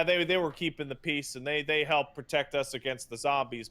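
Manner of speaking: usual speaking voice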